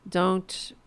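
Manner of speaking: ordinary talk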